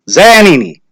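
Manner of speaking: loud roll call; name called out loud. A narrator's reading. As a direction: call the name across the crowd. Calling names.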